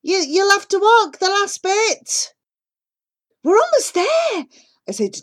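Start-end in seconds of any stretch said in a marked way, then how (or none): 0.0-2.3 loud, high shout over engine noise; driver shouting. A narrator's reading. Taxi driver telling family to walk, loud and high. 3.4-4.5 put-on high, eager girl's voice; bright child reassuring a friend; young girl. A narrator's reading. Asha telling Jivan they're nearly home, high and eager.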